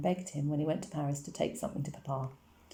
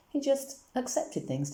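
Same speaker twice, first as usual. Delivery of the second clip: much higher and slow, wondering; wistful visitor; 9 semitones higher, slower than usual. A narrator's reading. Herr Rosenfeld remembering Uncle Julius, much higher and slow. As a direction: linger on it, fondly puzzled. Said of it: "said Herr Rosenfeld".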